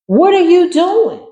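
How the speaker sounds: high, slow, drawn-out sassy put-on voice; mocking sass. Reader mocks the pearl-clutching intruder, sassy high drawl.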